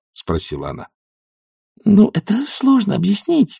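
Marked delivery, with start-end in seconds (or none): 1.8-3.6 very high, flustered voice; nervous, high-pitched hedging; floundering. A narrator's reading. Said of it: "барахталась в словах Маград".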